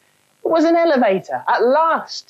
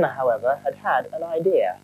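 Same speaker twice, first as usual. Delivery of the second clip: usual speaking voice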